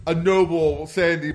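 ordinary delivery

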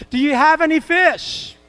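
high, loud call across the water; Jesus hailing the fishermen on the boat, called loud; calling out from shore. Retold or imitated. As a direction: shout it out to the boat.

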